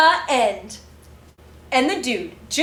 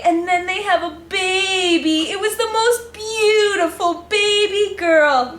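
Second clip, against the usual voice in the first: put-on higher, tearful, melting over the baby; gushing; 7 semitones higher than usual. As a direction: gush like it's the sweetest thing ever.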